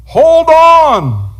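high, loud, slow command yell; captain's shout. Retold or imitated. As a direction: bellow the order over the water, drawn out and high. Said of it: "And the captain's like".

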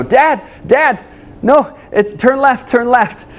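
put-on high, bossy little-boy voice; little son insisting. Young son in the booster seat telling dad which way, high bossy child voice. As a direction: squeak it out like a small kid correcting dad. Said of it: "he would point out the window".

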